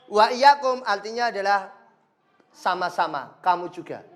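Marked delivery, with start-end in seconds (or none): none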